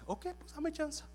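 tiny, very high little-dog voice. Acted. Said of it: "El perrito le dice". (vocecita aguda de perrito)

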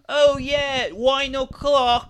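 mocking the show's characters; tipsy party mocking. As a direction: draw it out like a tipsy reveller. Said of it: "They have, like".

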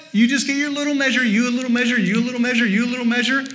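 quick, repetitive singsong handing-out; mock stingy doling. Preacher mimicking God doling out little measures, brisk and perfunctory. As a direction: rattle it off evenly as if portioning scraps. Acts out doling out small portions.

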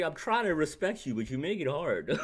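fed-up retort. Retold or imitated. Co-host telling LeBron he's hard to respect, fed-up and loud. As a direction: talk down to him, fed up and half laughing. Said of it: "I was fed up".

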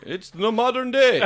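high-pitched mock Egyptian accent. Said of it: "Was that your Egyptian accent?".

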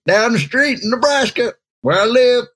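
a sudden high voice he can't explain; odd high voice. Real, not acted. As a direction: let the voice jump high and go odd. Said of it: "I don't know why I'm talking like this".

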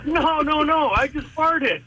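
high-pitched, drawn-out protest; protesting denial. Retold or imitated. Driver to accusing buddy, protesting innocence. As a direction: plead your case, stretching the confession.

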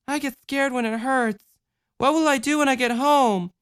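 put-on small girl admitting fear; scared little girl. A narrator's reading. Child voice.

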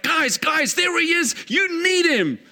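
high, eager call to the crowd; bursting with excitement, pointing someone out; healed man excitedly telling the rulers about Jesus. Retold or imitated. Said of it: "He's like so excited".

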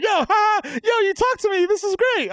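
high-pitched thrilled fanboy voice; excited fan. Retold or imitated. Imitating a Twitter stranger thrilled at a reply, high gushing voice. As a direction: gush it up high like a starstruck fan.

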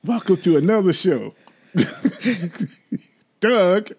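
high, swooping host greeting with a laugh; hammed-up, high-pitched welcome to the show; mock show intro. Mock second take of show opening.

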